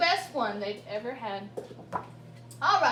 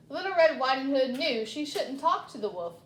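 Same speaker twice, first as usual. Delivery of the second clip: plain reading voice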